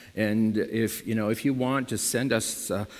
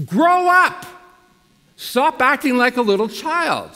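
much higher and louder, a sharp scolding shout; stern rebuke; 11 semitones higher, 7 dB louder than usual. Acted. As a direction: scold them like a fed-up parent.

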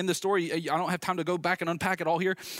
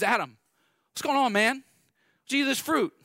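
raised, higher questioning call; God confronting Adam; 9 semitones higher, 3 dB louder than usual. Acted. God confronting Adam about the fruit, raised and demanding.

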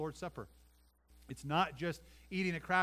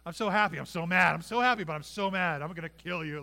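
much louder, mock torn between joy and rage; mock frantic parent; 9 dB louder than usual. Pastor voicing parents who find lost Jesus, hammed-up frantic. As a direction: blurt it out like a frantic relieved parent. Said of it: "the flood of emotion".

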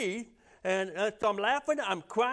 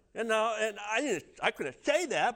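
ordinary delivery

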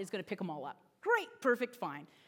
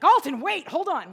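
dad calling out; 5 semitones higher, 11 dB louder than usual. Retold or imitated. Dad calls after running child.

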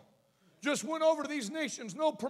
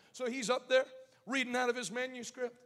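ordinary delivery

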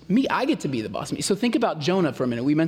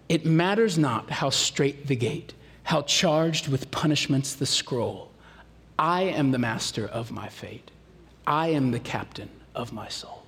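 Morgan Freeman reading; 4 semitones lower than usual. Retold or imitated. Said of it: "read by Morgan Freeman in that sweet, sweet voice".

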